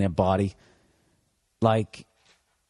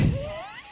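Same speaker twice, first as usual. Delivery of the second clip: high laugh; 6 dB quieter than usual. Real, not acted. Laughs at his own singing.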